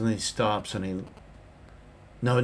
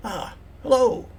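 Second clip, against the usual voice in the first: quirky NPC voice; 13 semitones higher, 7 dB louder than usual. Acted.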